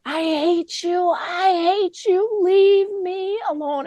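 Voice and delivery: high, slow, angry retold scream; imitated screaming. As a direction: voice the mother's outburst, high and drawn out. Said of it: "she's screaming at my daughter".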